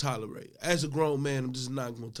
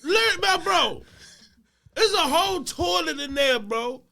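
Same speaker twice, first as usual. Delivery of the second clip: high, loud mock telling-off; incredulous scolding; 10 dB louder than usual. Custodian telling the boy off about the toilet, incredulous scolding. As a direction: scold at full voice in comic disbelief.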